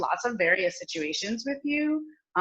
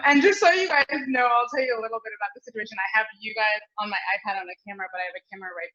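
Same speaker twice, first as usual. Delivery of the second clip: usual speaking voice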